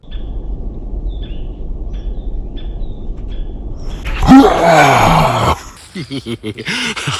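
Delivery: hearty burst of laughter; loud laugh. Real, not acted. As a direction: laugh loudly after the scare. Laughs after scaring viewers.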